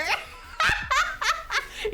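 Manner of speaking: high shrieking laugh. Real, not acted. Retelling the comedian's water bit, shrieking laugh.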